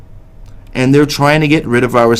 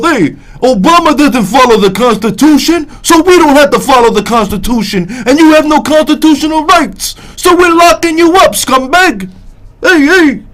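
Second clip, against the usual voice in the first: high, loud mock-cop Brooklyn accent; 13 semitones higher, 4 dB louder than usual. Host imitating a cop who admits ignoring the Constitution, exaggerated Brooklyn accent. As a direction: put on a strident Brooklyn cop voice, pitched way up. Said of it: "in his Brooklyn accent, he goes".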